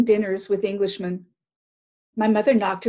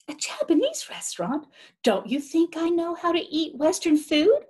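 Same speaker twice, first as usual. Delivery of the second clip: higher, miffed retort; indignant; 8 semitones higher than usual. Acted. Mother bristling at the father's assumption, miffed. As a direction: bristle at the suggestion.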